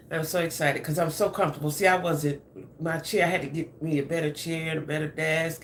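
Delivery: usual speaking voice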